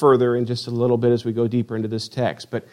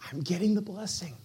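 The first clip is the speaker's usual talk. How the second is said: much softer, slightly higher aside; hushed thinking to himself; quiet inner thought; 4 semitones higher, 13 dB quieter than usual. Acted. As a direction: voice a secret, eager thought under your breath.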